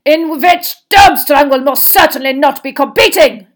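high, loud, furious declaration; exploding in anger. A narrator's reading. Headmaster withdrawing Durmstrang, exploding angrily. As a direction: burst out with forceful indignation. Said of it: "exploded Karkaroff".

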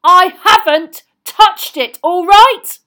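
slow, very loud, high denial; full-force angry shout; roaring back. A narrator's reading. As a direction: roar it back defensively. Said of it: "Ron roared back".

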